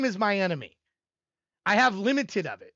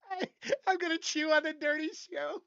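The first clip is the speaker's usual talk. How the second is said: laughing; 4 semitones higher, 7 dB quieter than usual. Real, not acted. Laughing at his own joke.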